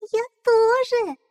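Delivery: chick voice. Acted. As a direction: squeak it like a newborn chick. Chick echoes.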